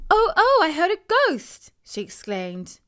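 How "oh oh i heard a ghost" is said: much higher, loud excited cry; animated child shrieking about a ghost; animated exclaim. Acted. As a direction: blurt it out thrilled and scared. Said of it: "Jemima's voice was far more animated".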